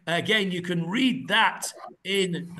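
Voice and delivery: ordinary delivery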